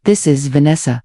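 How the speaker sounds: ordinary delivery, nothing marked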